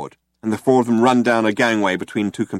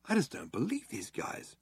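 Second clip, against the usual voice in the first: muttering; 3 semitones higher, 15 dB quieter than usual. A narrator's reading. Ford muttering to himself about the cops, soft. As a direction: mutter under your breath. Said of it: "muttered Ford".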